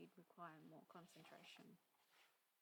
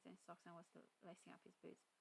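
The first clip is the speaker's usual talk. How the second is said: ordinary delivery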